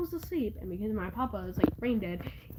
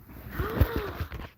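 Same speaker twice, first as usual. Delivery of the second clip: surprised gasp; 10 semitones higher than usual. Real, not acted. Spots hamster on camera.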